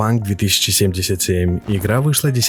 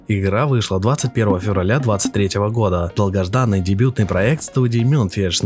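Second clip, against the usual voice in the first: ordinary talk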